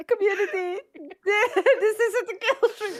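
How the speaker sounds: high, helpless laughing speech; laughing through words. Real, not acted. Host reacting to the ear-licking joke, talking through laughter. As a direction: try to talk while cracking up.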